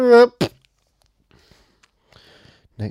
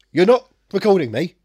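loud mock Michael Caine outburst; Michael Caine impression; 5 semitones higher, 8 dB louder than usual. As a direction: bark it like Michael Caine, higher and louder. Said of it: "the Michael Caine podcast".

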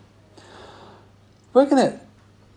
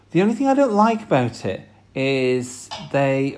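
normal talking voice

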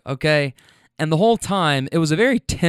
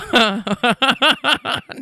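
high cackling laugh; 9 semitones higher than usual. Real, not acted. Teller cracks up at his own story, real laughter.

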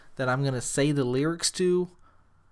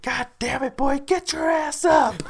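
high, slow, louder hammed-up coach holler; mock coach yell; 13 semitones higher, 5 dB louder than usual. Coach yelling at the hurt player to get up, mock holler. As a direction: bark at the boy to get up.

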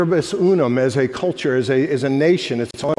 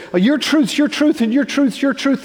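high, dismissive singsong mock; much higher mock voice, your leaned on; mocking relativism; 10 semitones higher, 3 dB louder than usual. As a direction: lampoon the shrug of relativism. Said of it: "the relativism that says".

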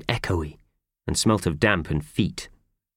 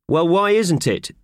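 demanding; 11 semitones higher, 5 dB louder than usual. Acted. Boss demanding an answer.

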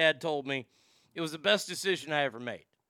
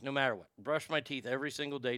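ordinary delivery

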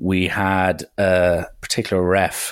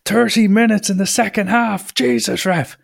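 high-pitched mock outrage at the ref; retold coach's scream, not at full volume; imitated screaming; 13 semitones higher than usual. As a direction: rail at the ref in a raised, strained pitch. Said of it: "getting screamed at by the opposition coaches".

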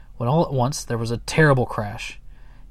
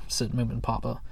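ordinary delivery, nothing marked

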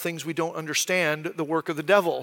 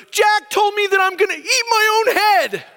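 imitating his little son, pitched way up and fearful; scared little boy; 11 semitones higher, 4 dB louder than usual. Little son tells his dad why he's scared, high child voice. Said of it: "and he's so scared".

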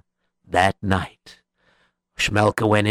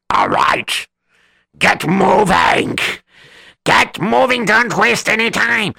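bossy urging; 9 semitones higher than usual. Acted. Boss urging gang.

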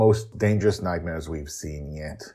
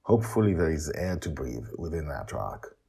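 ordinary delivery

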